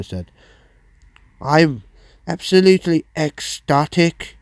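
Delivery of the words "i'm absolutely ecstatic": higher, louder bad London accent; London accent attempt. Presenter voicing a freed prisoner's quote, attempted London accent. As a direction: have a go at a London accent and miss. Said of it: "I can't do a London accent".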